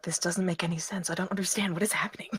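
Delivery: soft, half-whispered confusion; hushed, baffled muttering; agitated muttering. Acted. Paces back and forth, confused.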